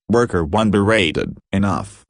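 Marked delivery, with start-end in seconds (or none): none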